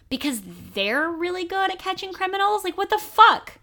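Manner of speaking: high-pitched disbelief, building to an outburst; incredulous. Real, not acted. Ridiculing Fudge's dragon suggestion.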